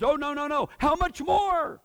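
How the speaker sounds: high, loud, emphatic voice of God; God pleading. Retold or imitated. God telling believers he still loves them, high and loud. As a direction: insist like a father who won't let go. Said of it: "God's message to you is".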